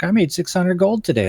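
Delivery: high, smug bragging wife; wife's bragging voice. Retold or imitated. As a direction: boast about the gold like she won. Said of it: "She's like".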